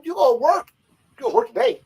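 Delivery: high, insistent mock threat; Rick Rude. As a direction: pitch it way up, meaning business. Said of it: "Rude was not playing with him".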